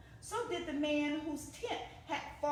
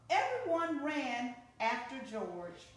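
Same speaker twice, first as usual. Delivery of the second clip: plain reading voice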